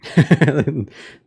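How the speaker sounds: loud burst of laughter after a fright; relieved laugh. Real, not acted. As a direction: laugh it off, shaken. Laughing after the jump scare.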